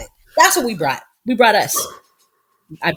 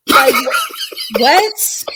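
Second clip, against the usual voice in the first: stunned disbelief; 13 semitones higher, much slower than usual. Real, not acted. Disbelief at child's answer.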